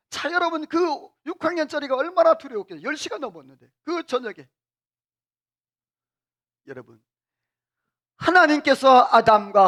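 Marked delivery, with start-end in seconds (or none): none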